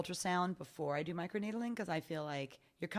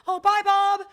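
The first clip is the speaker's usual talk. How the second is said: high and loud, cheery goodbye; excited cell chatter; 10 semitones higher, 13 dB louder than usual. Pretend. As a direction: wave off a friend brightly. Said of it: "the cells are like".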